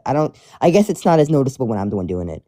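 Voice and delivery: ordinary talk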